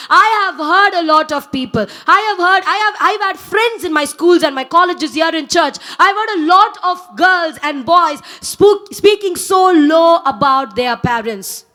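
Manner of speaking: normal talking voice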